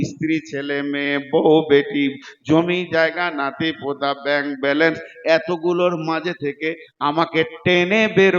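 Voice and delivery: emphatic, insistent Bengali sermon voice; full-force preaching. Acted. Preacher explaining why the damned want death, full-force preaching. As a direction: press each point home to the crowd.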